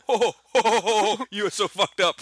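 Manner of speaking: high, loud hooting ho-ho-ho; hammed-up, high-pitched loud mimicry; Joe impersonation. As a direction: imitate a friend's big scandalized laugh. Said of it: "That's a pretty good Joe impersonation".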